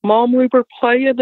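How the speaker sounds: high, imitating a scared boy; frightened son. Son telling mom what they saw, high and scared. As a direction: tell it like a shaken child. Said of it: "Adam said".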